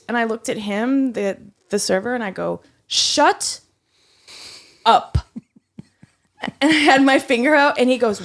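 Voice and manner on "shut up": high, loud snapped shut up; retold shut up. A server telling a rude coworker to shut up, loudly. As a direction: bite it off at him. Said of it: "said it loud".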